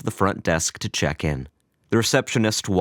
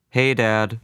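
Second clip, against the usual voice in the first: flatly; 4 semitones higher than usual. A narrator's reading. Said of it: "Albert said flatly".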